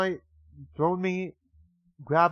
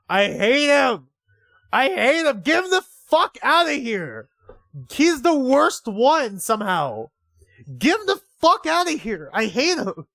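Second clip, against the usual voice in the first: loud, high angry yelling at the screen; real furious outburst; 9 semitones higher, 7 dB louder than usual. Real, not acted. Player yelling in fury at villain Inko, loud and high. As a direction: explode at the character you hate.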